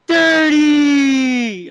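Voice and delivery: "Dirty!" yelled high and loud, drawn out; shouted punchline. Acted. Teller shouting "Dirty!" at the punchline, loud and high. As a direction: belt out "Dirty!" as the big punchline.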